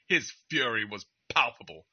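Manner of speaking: a lightly put-on condescending British voice. Narrator taunting the angry man at the airport, light condescending British. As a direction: sneer it like a smug posh Brit, understated. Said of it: "in the most, like, condescending British way possible".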